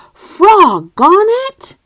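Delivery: high, loud shout; big dad whooping; loud cheer. Acted. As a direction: bellow it while splashing. Loud voice narrated.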